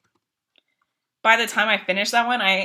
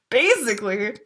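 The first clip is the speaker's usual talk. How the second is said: ordinary talk, nothing marked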